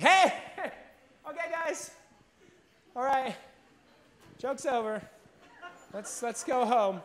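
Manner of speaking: high, calling into the dark; nervous call to hidden friends. Retold or imitated.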